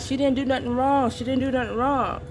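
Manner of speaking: mild mock yell of a daughter; retold yelling. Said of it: "She's like yelling out".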